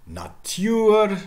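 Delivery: "Natur" as na-TYOOR; high, loud "Natur" with y-glide; Middle English pronunciation. Shown as a demonstration. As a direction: stretch the end of "Natur". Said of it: "more examples".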